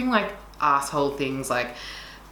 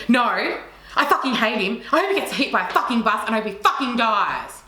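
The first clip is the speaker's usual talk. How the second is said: a toned-down retelling of a furious outburst; understated rage; 8 semitones higher, 3 dB louder than usual. Retold or imitated. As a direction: she admits the real one was far louder and angrier. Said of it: "ten decibels higher than that with a lot more rage".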